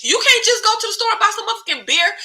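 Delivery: high, rapid-fire disbelief; exasperated scolding. Acted. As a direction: scold in a high, incredulous rush.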